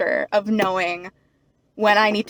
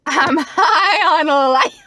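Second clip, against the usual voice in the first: laughing; 6 semitones higher, 6 dB louder, much slower than usual. Real, not acted. Laughing loud slow joke.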